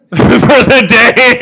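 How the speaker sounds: high, loud, drawn-out words through laughter; laughing. Real, not acted. Storyteller cracking up over a friend's drinking, laughing. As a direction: crack up mid-sentence.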